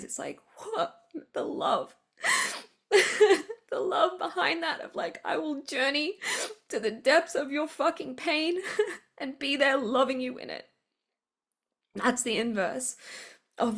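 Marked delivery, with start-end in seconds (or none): 0.0-1.0 overwhelmed. Real, not acted. Overcome by emotion. 1.3-1.9 breaking whisper, near tears; tearful half-whisper; choked up. Real, not acted. Chokes up on compassion. 2.9-3.7 tearful laugh. Real, not acted. Emotional laugh. 3.7-10.7 high, emotional, half-laughing; tearful laughing voice, pitched up; laughing through tears. Real, not acted.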